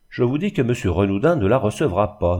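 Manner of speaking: usual speaking voice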